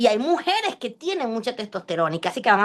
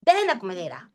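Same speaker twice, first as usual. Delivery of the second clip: mock scolding; 5 semitones higher than usual. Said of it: "le vas a echar cuatro gritos".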